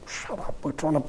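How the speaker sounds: whispered telling-off; stern hushing. Acted. Crowd orders the blind beggar quiet, stern hushed whisper. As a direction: shush someone under your breath. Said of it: "sternly ordered him to be quiet".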